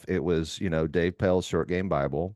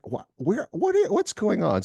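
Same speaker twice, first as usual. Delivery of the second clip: high-pitched stammering disbelief; mock bewilderment. Golf buddy reacting to a dunked shot, bewildered. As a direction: imitate a flabbergasted buddy, voice shooting up.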